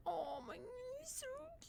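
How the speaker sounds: very high, soft gush; breathy high coo. Real, not acted. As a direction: melt over him softly. Cooing over a hamster.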